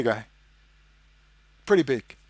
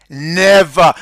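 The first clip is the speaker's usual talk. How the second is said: NEVER, hit hard and much louder; loud emphatic never; 2 semitones higher, 10 dB louder than usual. Real, not acted. Insisting to viewers he never lies, loud emphatic never. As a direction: slam the single word down.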